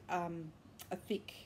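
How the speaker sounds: normal talking voice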